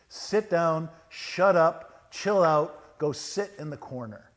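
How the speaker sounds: loud commands, easing off at the end; scolding. Acted. Jesus ordering the storm to behave, scolding. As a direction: tell off a naughty child in short bursts. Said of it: "He scolds the storm".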